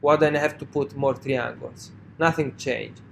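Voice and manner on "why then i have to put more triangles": ordinary talk, nothing marked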